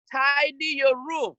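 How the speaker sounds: ordinary talk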